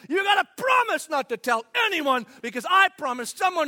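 loud, breathless secret-passing; the next friend blurting it on; excited gossip. Retold or imitated. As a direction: rush to pass on the secret.

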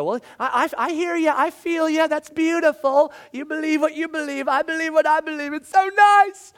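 high, sugary, over-sweet voice; hammed-up syrupy agreement; mock niceness. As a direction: parody a limp people-pleaser. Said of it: "just kinda be really nice about it and go".

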